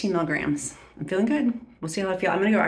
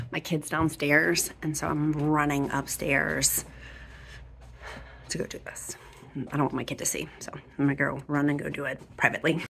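hushed; 10 dB quieter than usual. Real, not acted. Vlogger sneaking upstairs away from her kid, hushed. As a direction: keep it down while slipping off upstairs.

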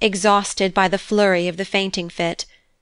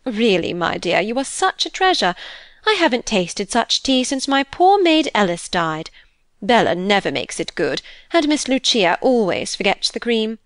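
lilting, grateful mistress voice with leaping pitch; gushing older lady. Acted. Mrs Coventry to the new governess over tea, gushing.

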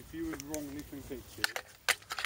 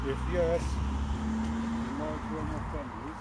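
calling out slowly; loud, slow, drawn-out call to the spirit; 10 dB louder, slower than usual. Real, not acted. Calling out to a ghost.

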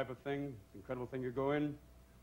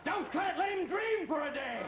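mock pleading shout; 13 semitones higher than usual. Pleading with doctor.

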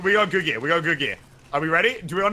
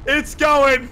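excited; 8 semitones higher, 4 dB louder than usual. Real, not acted. Rocket flying off.

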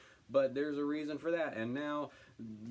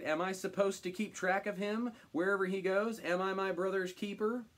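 higher-pitched, challenging questions; indignant retort; 6 semitones higher than usual. Acted. Cain dodging God's question about his brother, indignant retort. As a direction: throw the questions back, indignant. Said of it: "Cain retorted".

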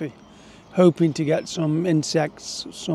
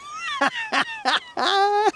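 short high-pitched laugh. Real, not acted. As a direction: laugh it off, amused. Fly flies off, he laughs.

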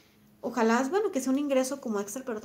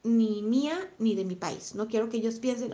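ordinary talk, nothing marked

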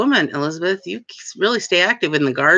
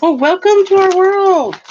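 excited; 14 semitones higher, 7 dB louder than usual. Real, not acted. Jokes with helper about redoing.

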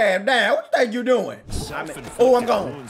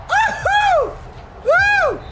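high woo-hoo whoops; much slower than usual. Real, not acted. Gamer sending opponent to bed, high victory whoops. Celebrating in game.

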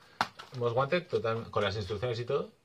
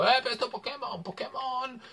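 much higher mock Maghrebi-accented seller; imitating Maghrebi accent; 14 semitones higher than usual. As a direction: play the street seller with a put-on Maghrebi accent, high-pitched. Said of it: "era magrebí".

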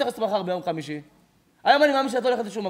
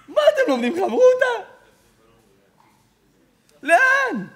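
mocking; 12 semitones higher, 5 dB louder than usual. Rabbi mocking the girl's outing.

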